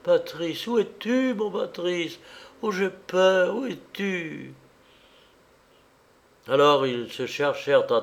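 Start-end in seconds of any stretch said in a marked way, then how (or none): 0.0-4.5 high, plaintive voice of a panicked woman; fearful high-pitched calling, without shouting; frightened cry. A narrator's reading. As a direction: plead for him to answer, pitched high. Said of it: "cria Coralie que les ténèbres affolaient".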